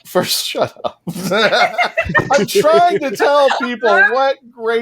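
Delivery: laughing as the words come out, pitch rising high. Real, not acted. Host laughing at his co-host while trying to pitch Big Finish. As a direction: giggle through the protest.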